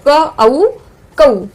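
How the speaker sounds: क, then औ, then joined कौ; syllable blending. Shown as a demonstration. Teacher adding the matra, part-by-part blending of क औ कौ. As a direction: sound out क औ कौ in separate beats. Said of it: "इस तरह से".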